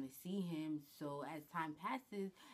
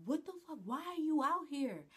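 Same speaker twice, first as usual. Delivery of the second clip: much higher and louder, alarmed; shocked outburst; 10 semitones higher, 6 dB louder than usual. Retold or imitated. Spotting the boyfriend on his stairs, a high startled outburst. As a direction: blurt it out, startled to see him. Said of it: "I'm like".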